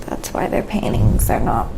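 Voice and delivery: ordinary talk, nothing marked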